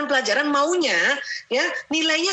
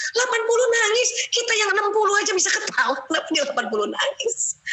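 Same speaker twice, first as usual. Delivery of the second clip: high, incredulous disbelief; puzzled wonder; 9 semitones higher than usual. Retold or imitated. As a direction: marvel at it, pitch up high. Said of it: "suka heran". (heran tak percaya, nada tinggi)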